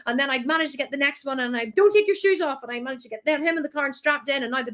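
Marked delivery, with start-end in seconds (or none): 1.8-2.6 higher, stern warning; scolding mum. Retold or imitated. Mum warning toddler about his shoes, scolding. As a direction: snap it at the kids.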